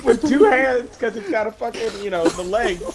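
high-pitched, helpless laughter through the words; can barely get the words out for laughing; laughing hard. Real, not acted. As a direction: laugh your way through the description. Cracking up describing the scene.

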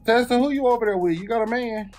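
normal talking voice

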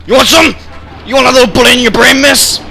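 high, loud gamer rage; angry yelling. Real, not acted. Player threatening stupid opponents, angry yelling. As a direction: yell threats at the top of the register.

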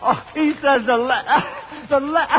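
breaking into laughter mid-word; can't finish for laughing. Real, not acted. Quizmaster laughing at the contestant's answer.

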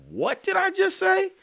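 sudden high, loud startled cry; startled disbelief. Retold or imitated. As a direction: catch yourself off guard and squeak it out. Startled by his own lyric.

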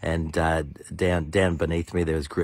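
ordinary delivery

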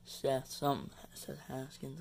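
ordinary delivery

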